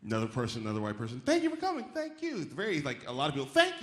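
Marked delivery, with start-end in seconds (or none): 1.2-2.4 high, pushy "thank you"; aggressive thank-you. Retold or imitated. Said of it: "very sort of aggressive". 3.5-3.8 high, pushy "thank you"; aggressive thank-you. Retold or imitated. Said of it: "very sort of aggressive".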